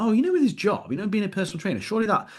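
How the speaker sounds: high, fretful voice of a worried father; imitating worried dad. Son mimics his worried dad over drinks, high and fretful. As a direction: lift the pitch, play the anxious dad. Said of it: "He's like".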